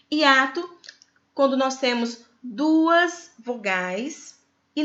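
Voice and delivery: duas vogais e said very slowly, rising on duas; slow explanation. Shown as a demonstration. Teacher defining a hiatus, slowly.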